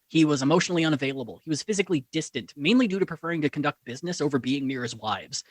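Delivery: ordinary delivery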